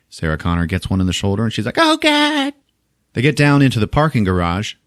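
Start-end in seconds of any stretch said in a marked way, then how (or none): 1.8-2.5 high yelp of pain; mock pain cry. Sarah stabbed in the shoulder, high yelp. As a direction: yelp like you were stabbed.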